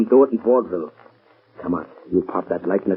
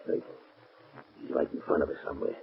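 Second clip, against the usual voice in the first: hushed, breathy, very soft urging; scared whisper; 2 semitones lower, 12 dB quieter, faster than usual. Acted. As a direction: whisper so the unseen man won't hear. Gangsters afraid of the unseen Shadow.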